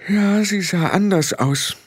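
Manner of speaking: high, rattled outburst; blurted out. A narrator's reading. Frau Grad blurts out Tiffany's change to Rob, rattled. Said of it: "entfuhr es Frau Grad".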